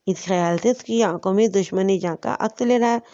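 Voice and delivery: plain reading voice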